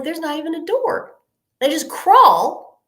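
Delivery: high, loud comic outrage; aghast disbelief. Retold or imitated. Aghast at bathroom without a door.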